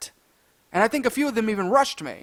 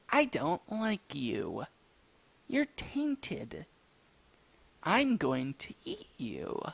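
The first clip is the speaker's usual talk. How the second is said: low, hushed, slow threat; sinister sandwich monster, low and quiet; menacing creature; 5 semitones lower, 10 dB quieter, slower than usual. Acted. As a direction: speak softly and slowly as a monster about to devour. Menacing sandwich creature speaks to him.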